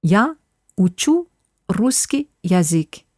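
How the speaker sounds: "учу русский язык" said slowly, word by word; slow demo. Shown as a demonstration. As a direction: spell it out slowly for learners. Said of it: "I'll say it again slowly".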